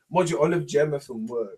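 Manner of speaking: usual speaking voice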